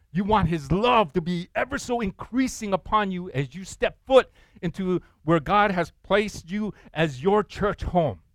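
ordinary talk

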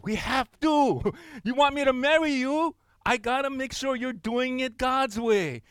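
high, slow, emphatic near-shout; firm loud retort. Retold or imitated. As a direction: put your foot down with a laugh. Pastor's firm reply to couple.

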